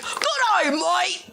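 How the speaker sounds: put-on failed Aussie accent, high and drawn out; slow botched Aussie accent; bad Aussie accent. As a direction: try an Aussie accent and miss. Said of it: "haven't been able to successfully do an Aussie accent".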